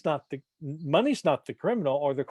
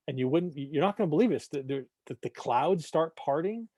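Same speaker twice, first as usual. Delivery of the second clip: ordinary talk, nothing marked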